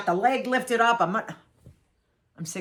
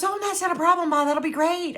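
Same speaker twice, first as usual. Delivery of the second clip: high, chirpy imitation of her daughter; daughter's voice; 10 semitones higher than usual. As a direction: mimic the cheerful grown daughter. Daughter's voice in retold story.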